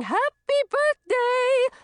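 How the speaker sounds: high, drawn-out celebratory cheer; loud, high birthday cheer; joyful shout. A narrator's reading. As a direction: shout it out like a crowd of excited fairies. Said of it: "Laughing and shouting".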